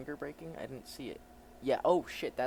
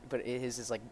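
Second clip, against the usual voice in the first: ordinary delivery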